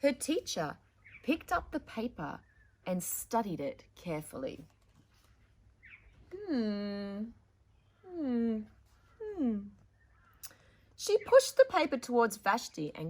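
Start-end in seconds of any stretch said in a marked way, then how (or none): 0.0-4.7 put-on louder, expressive read-aloud to children; story narration. 6.5-9.6 thoughtful hmm. Acted. Teacher studies the paper.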